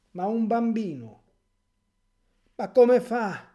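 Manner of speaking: loud, high, indignant cry; indignant outcry. Real, not acted. Priest rails at his flock, loud and indignant. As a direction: throw the question out in outrage.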